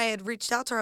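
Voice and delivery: usual speaking voice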